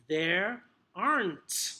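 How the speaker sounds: slow American "there aren't", r clearly sounded; emphatic American r in "there aren't"; American accent. Shown as a demonstration. Teacher modelling phonetics for trainees, American accent. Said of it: "If it's gonna be more American".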